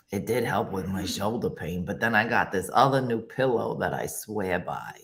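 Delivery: lightly voiced low mobster; a hint of someone who joined the mob. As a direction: talk low like a mob member. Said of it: "sounds like you've suddenly joined the mob".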